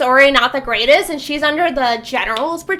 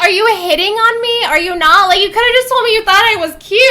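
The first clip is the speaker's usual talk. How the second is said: higher, louder and fast, half-mocked exasperation; mock exasperated Jude; 8 semitones higher, 5 dB louder, much faster than usual. Jude to Locke, mock exasperated and fast. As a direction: fire off the questions in mock disbelief. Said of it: "It's like".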